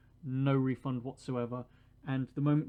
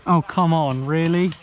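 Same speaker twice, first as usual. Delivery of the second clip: loud disbelieving complaint; annoyed shout; 2 semitones higher, 14 dB louder than usual. Real, not acted. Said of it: "my annoyed self".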